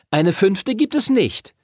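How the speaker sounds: high, slow cheeky quip. A narrator's reading. Pupil Timothy teases the teacher about rules, cheeky. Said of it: "Timothy grinste frech".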